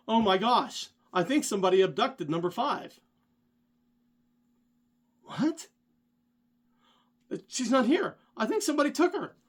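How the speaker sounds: high, loud rising panic; panicked alarm. Retold or imitated. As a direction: sound like someone just vanished in front of you. Friend panics about missing girl.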